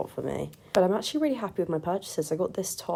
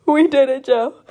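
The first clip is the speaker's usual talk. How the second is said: high, loud, laughing cheer; excited laughing cheer; 8 semitones higher, 14 dB louder than usual. Real, not acted. Triumphant cheer entering Trader Joe's.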